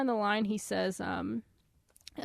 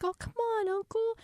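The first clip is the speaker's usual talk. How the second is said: much higher wheedling niece; coaxing Delphi; 12 semitones higher than usual. Acted. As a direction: sweet-talk your uncle, high. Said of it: "But then Delphi's like".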